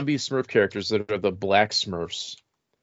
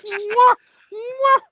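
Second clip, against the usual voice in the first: high smooching kisses; exaggerated high-pitched mwah kisses; loud kiss noises; 4 dB louder than usual. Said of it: "And I'd be like".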